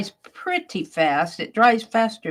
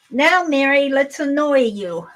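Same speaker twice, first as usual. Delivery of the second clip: nose voice; 6 semitones higher, 3 dB louder than usual. Pretend. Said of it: "My nose says".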